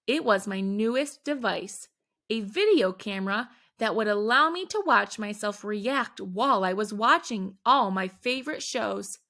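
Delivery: ordinary delivery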